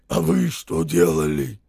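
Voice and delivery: low, loud hoarse growl; hoarse accusation. A narrator's reading. Soldier accusing the townsmen, hoarse growl. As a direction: throw it back in a deep rough voice.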